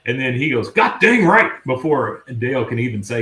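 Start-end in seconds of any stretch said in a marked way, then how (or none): 0.7-1.5 loud, high mock exclamation; imitated burst of fired-up enthusiasm; emphatic outburst. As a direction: jump in with hearty agreement. Hank's emphatic outburst before Dale answers.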